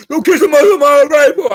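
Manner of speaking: loud pleading yell on the repeat. Retold or imitated. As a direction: burst out with the repeat. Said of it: "I was begging him".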